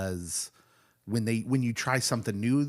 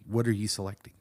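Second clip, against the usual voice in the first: ordinary delivery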